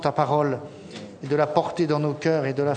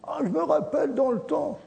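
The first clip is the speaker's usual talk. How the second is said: put-on old man reminiscing; 9 semitones higher than usual. Old person reminiscing mocked.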